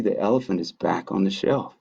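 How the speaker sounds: ordinary delivery, nothing marked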